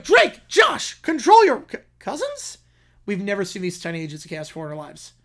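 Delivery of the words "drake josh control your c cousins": very high, loud exasperated voice; exasperated woman. Acted. Theater worker scolding Drake and Josh, high exasperated shriek. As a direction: shriek it at them, fed up. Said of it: "She's like".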